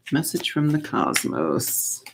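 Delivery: slow, hushed calling to the cosmos; mystical invocation. Shown as a demonstration. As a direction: summon the cosmos softly, like a ritual. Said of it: "Let's do that".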